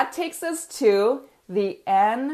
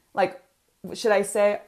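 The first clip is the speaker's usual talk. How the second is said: ordinary talk, nothing marked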